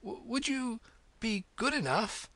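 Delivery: high, halting, breathless request; panting. A narrator's reading. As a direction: pant it out in pieces while running. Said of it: "Alice panted out".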